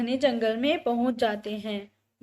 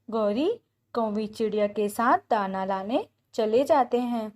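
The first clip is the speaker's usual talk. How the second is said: ordinary delivery, nothing marked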